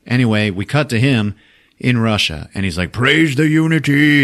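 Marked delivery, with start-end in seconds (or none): 2.9-4.2 slow, loud, higher mock villain; villain proclamation. Steppenwolf joins the boxes, slow loud mock proclamation. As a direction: proclaim it like a hammy conqueror.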